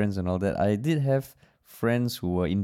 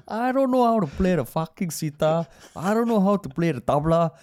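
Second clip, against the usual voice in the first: imitating an Indian accent, much higher and louder, laughing; hammed-up Indian accent, exasperated and rising; mock Indian friend complaining about sitar in accent; 8 semitones higher, 4 dB louder than usual. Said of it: "I will use the accent because I'm Indian".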